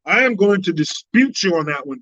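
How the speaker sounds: ordinary talk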